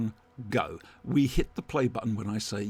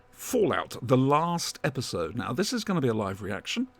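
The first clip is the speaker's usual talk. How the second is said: usual speaking voice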